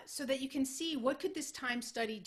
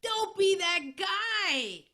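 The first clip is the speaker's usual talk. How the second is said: much higher, louder and drawn out slowly; emphatic punchline; 11 semitones higher, 11 dB louder, much slower than usual. Acted. Speaker to webinar audience after the joke, big slow emphatic warning. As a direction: land the moral big and slow, every word leaned on.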